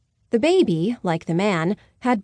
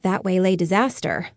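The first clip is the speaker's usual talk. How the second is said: ordinary delivery, nothing marked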